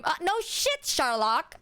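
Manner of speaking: high, sarcastic jab; mock the obvious. Real, not acted. Sarcastic retort to friend.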